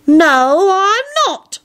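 high, indignant denial; affronted Quentin imitation. A narrator's reading. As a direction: deny it as if deeply affronted. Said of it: "rather good affronted Quentin imitation".

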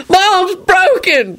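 high, slow wail of pain; hammed-up injured howl. A narrator's reading. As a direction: wail it out, drawn long and high. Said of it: "Ron howled".

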